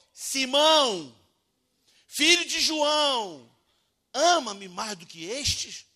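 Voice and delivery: very slow, loud and high, each word stretched; preacher thundering Jesus's question; drawn-out proclaimed quote. Acted. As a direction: proclaim it slowly to the back of the church.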